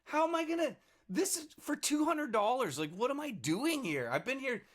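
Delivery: pitched far up, mock frantic disbelief; retold exasperation. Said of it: "And I'm like".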